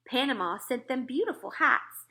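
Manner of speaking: plain reading voice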